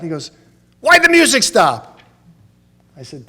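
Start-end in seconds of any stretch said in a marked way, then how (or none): 0.8-2.0 mock shout, pitched up high; hammed-up outraged father; dad demanding. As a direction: bellow it as the indignant dad. Dad demanding music back.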